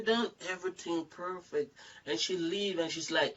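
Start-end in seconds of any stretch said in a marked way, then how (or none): none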